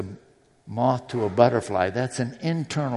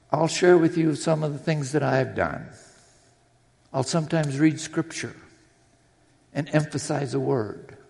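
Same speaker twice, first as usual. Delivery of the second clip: ordinary delivery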